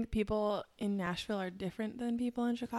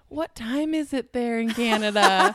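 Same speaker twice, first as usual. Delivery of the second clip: louder, slower mock question; mock text reading; 3 semitones higher, 7 dB louder, slower than usual. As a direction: read the text like a clueless friend. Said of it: "I would get texts being like".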